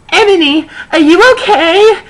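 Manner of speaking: put-on high-pitched worried girl voice; a loud, high fretting friend; concerned friend. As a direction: squeak it out with concern. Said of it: "Bloody Mary asked in a concerted voice".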